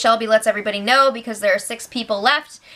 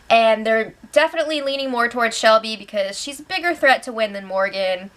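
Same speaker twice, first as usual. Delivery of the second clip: normal talking voice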